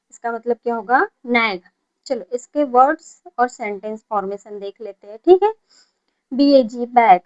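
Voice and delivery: normal talking voice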